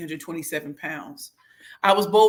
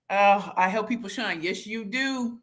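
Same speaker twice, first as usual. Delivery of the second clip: ordinary talk, nothing marked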